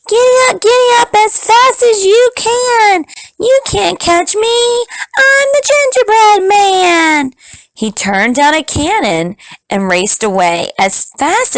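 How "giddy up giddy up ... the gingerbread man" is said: high, bold, teasing chant; high taunting cookie voice. Acted. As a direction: taunt them in a tiny high voice. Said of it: "and said".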